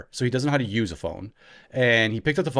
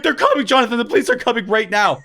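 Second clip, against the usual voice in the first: mock alarm; 9 semitones higher, 4 dB louder, faster than usual. Brothers scaring Jonathan with police.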